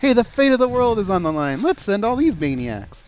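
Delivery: high, loud sarcastic mock hero line; mocking movie voice. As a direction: ham it up like a cheesy blockbuster.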